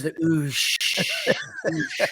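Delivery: "oosh" with a long oo and long sh; drawn-out oosh. Shown as a demonstration. Guest showing the O's in douche, lingering on "oosh". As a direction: stretch the vowel and hush in "oosh". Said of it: "it's like all the O's".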